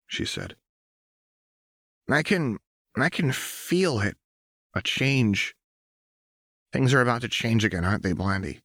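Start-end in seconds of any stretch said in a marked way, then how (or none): 2.1-8.6 lightly raised voice for Jinx; Jinx musing half to herself. A narrator's reading. Jinx to Lux, sensing change, lightly voiced.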